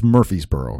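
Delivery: Murfreesboro with the second r dropped, like "MUR-fees-boro"; local pronunciation. Shown as a demonstration. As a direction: say Murfreesboro as "Murfeesboro", no r after the f. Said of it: "is how it's pronounced".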